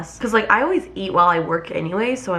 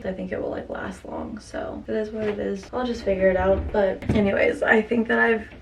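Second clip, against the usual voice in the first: ordinary talk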